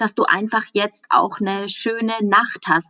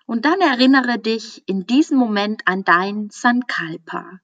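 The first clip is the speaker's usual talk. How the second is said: guided meditation. Acted. Teacher guiding a listener into Yoga Nidra, calm meditation voice. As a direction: ease the listener into stillness, unhurried.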